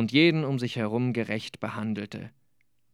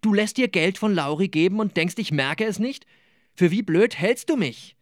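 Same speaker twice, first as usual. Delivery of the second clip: higher-pitched, indignant acted outburst; calling out angrily; 7 semitones higher than usual. A narrator's reading. Gabi accusing Nicola of taking Lauri's money, indignant calling out. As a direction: call it out as an angry accusation. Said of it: "Aber er rief".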